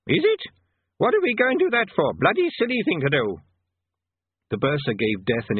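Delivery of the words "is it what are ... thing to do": put-on high, loud, deaf old man; doddery old wizard. A narrator's reading. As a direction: shout like a deaf old codger. Arch-Chancellor voiced.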